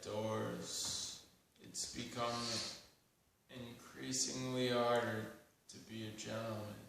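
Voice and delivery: measured spoken-word poem with long pauses; deliberate poetry reading, lines left to hang; poetry recitation. Acted. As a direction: recite it solemnly, phrase by phrase.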